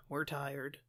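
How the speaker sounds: low, quiet, weary mock parent voice; worn-out parents. Parents giving up on hiding presents, mock weary. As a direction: sigh it out as exhausted parents.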